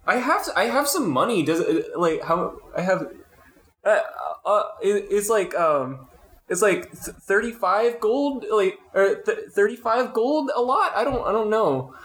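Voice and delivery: high, halting, unsure voice; hesitant player character. Acted. Newcomer tells the dart player about their money, hesitant.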